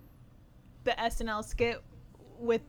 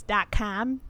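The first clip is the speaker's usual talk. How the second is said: put-on Midwest accent. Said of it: "she has this very Midwest accent".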